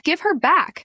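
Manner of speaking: loud, high desperate yell; scream. Acted. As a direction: yell for the baby back. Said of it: "I screamed".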